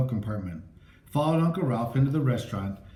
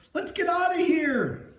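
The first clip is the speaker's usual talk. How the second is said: high, frantic scream to flee; terrified high-pitched escape cry; panicked cry. A narrator's reading. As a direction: shriek it like you're running for your life. Fleeing the ghost in panic.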